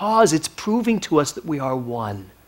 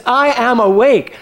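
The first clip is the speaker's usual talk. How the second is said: high, loud and drawn out; ringing, slowed "I am awake"; emphatic proclamation; 8 semitones higher, 7 dB louder, slower than usual. Real, not acted. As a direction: declare the theme, weight on every word. Proclaims the year's theme.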